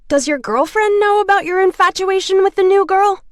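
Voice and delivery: a big bully squeaking up high to mock. A narrator's reading. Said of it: "His voice was high, like a female's".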